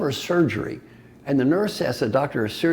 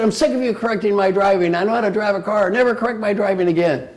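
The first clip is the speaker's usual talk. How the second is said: loud, higher, fed-up rebuke; husband snaps at his wife over driving; angry husband; 7 semitones higher, 5 dB louder than usual. Retold or imitated. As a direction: scold the wife for correcting. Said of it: "I got sick of it".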